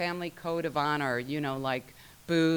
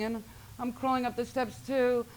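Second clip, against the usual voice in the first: ordinary talk, nothing marked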